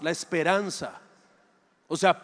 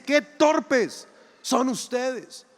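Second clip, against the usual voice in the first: higher, very slow, each word hammered; angry rebuke; 5 semitones higher, much slower than usual. Acted. Jesus annoyed at his doubting disciples, higher and very slow. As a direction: let "Qué torpes" ring out in annoyance. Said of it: "Jesús se enoja y les dice".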